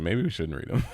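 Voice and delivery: normal talking voice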